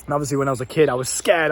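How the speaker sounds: usual speaking voice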